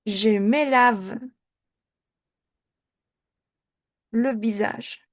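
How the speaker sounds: slow, spaced je me lave; slow model. Shown as a demonstration. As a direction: model it slowly. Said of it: "Cómo lo convierto". (je me lave, lentement)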